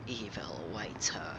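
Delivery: hushed, menacing whisper. Pretend. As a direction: breathe it like a villain. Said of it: "whispered menace".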